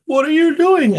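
put-on high, shocked child voice; retold kid asking what's going on; boy's voice. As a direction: squeak it like a startled boy. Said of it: "he said".

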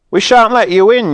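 high, loud shout at the door; defiant yell. Acted. Joe shouting at the bears outside, loud defiant yell. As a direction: yell it through the door to keep them out. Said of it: "yelled Joe".

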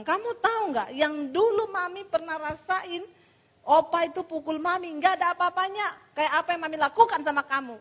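put-on high, sharp scolding of her child; scolding mother. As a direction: lash out at the kid, voice pitched high. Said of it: "saya bilang".

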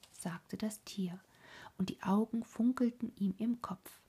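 ordinary delivery

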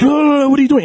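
high, urgent protest as the recruiter; mock the guy's flustered objection; alarmed pushy recruiter. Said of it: "the guy was like".

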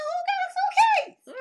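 high, loud, laughing disbelief; shocked question. Retold or imitated. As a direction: react to the suitcase like you can't believe it. Mom reacts seeing suitcase.

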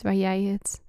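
usual speaking voice

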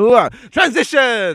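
hammed-up transition. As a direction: announce the transition with mock glee. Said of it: "I love transitions like this".

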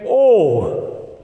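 a light Midwestern "Oh." as a passing imitation; Midwestern accent. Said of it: "than a Midwesterner saying".

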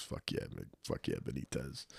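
low, quiet, gruff coach. Retold or imitated. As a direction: growl it approvingly.

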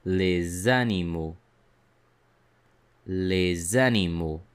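Les animaux linked with a z sound; teacher models the liaison in Les animaux; liaison demo. Shown as a demonstration. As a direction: join Les animaux as lez-animaux. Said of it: "Notice how it is pronounced".

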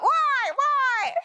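loud, high squawked "why"; crow cawing. Retold or imitated. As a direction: caw it out like a crow. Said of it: "like the crows in my backyard".

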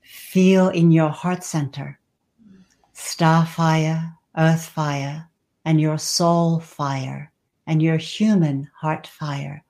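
ordinary talk, nothing marked

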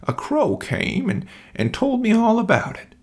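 put-on high thin old bear voice; old Nan. A narrator's reading. Old Nan answering the king, high and thin. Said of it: "said Old Nan".

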